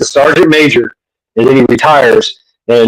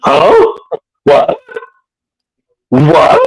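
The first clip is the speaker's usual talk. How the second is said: shocked mom; 11 semitones higher, much slower than usual. Retold or imitated. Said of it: "And he said".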